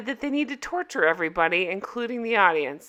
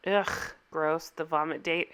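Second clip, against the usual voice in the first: annoyed ugh; 5 semitones lower, 6 dB quieter than usual. Real, not acted. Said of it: "I am so thoroughly grossed out".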